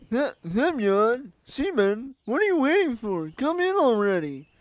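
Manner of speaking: high-pitched camp leader voice; high character voice. Acted. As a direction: voice her high and loud. Voicing Olga, the camp leader.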